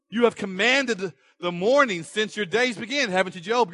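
God needling Job; sarcastic God. Acted.

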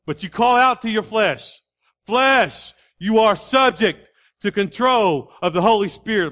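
high, forceful preacher's proclamation; full-force preaching. Real, not acted. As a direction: command the flesh, punching each opening word.

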